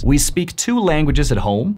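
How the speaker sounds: ordinary delivery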